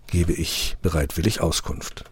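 plain reading voice

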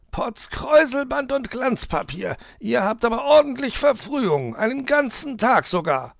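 very high, loud put-on fox voice; astonished fox. Herr Fuchs greets the too-early sled pullers, astonished high voice.

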